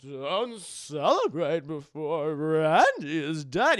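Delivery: slow, hammed-up warning with swooping pitch; loud, stretched-out mock-ominous voice; old mentor's warning. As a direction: draw it out like a dramatic old master.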